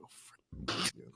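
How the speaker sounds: breathy whisper of dread. Retold or imitated. Traveller whispering as a guard stops him, hushed. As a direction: hiss it under your breath.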